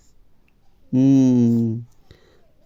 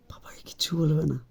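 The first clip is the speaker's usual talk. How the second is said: put-on conspiratorial whisper into the baby's ear; mock secret whisper; 3 semitones higher, 8 dB quieter, faster than usual. Father mimicking the mother's ear-whisper, playful whisper. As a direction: hush it like a shared secret. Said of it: "কানে কানে".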